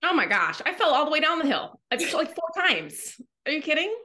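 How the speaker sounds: high, fast exasperated outburst; exasperated disbelief. Acted. Podcaster complaining of falling down the hill, exasperated.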